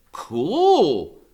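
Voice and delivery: put-on high, loud wisecracking boy; Freak's high smart-aleck voice. A narrator's reading. Boy joking about his own birthday, high and cocky. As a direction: crack the joke like a cocky kid. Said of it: "Freak says".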